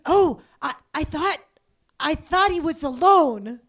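loud, slowed and puzzled mock reply; dazed stranger. Dazed woman explaining she thought the baby was alone, loud and slow. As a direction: drag it out like someone not fully there. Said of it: "she goes".

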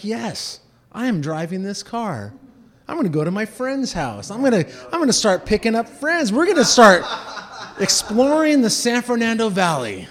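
high, rising, increasingly loud boast; stoned teen hyping himself up; giddy bragging. Retold or imitated. High teen fantasizing about driving.

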